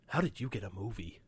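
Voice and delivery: lightly voiced low insult comic; a hint of a gruff heckling comic, pitched low; Rickles. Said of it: "Rickles would go up to him, he's like".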